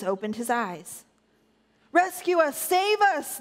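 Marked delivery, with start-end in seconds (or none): 1.9-3.4 high-pitched cry to be saved; shrieked plea. A narrator's reading. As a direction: narrator acting out frightened shrieks. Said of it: "they shrieked".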